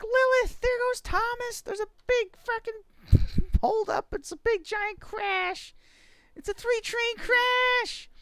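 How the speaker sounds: very high, drawn-out play-acted panic; mock alarm. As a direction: announce a toy disaster in a squeal. Play-acting crash alarm to child.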